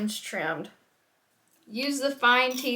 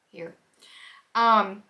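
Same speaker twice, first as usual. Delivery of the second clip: usual speaking voice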